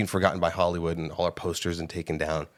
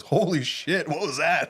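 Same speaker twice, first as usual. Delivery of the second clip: high-pitched imitation of a shocked crowd; imitating an astonished moviegoer; stunned exclamation; 8 semitones higher than usual. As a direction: blurt it out way up high, mock-stunned. Imitating the stunned crowd around her.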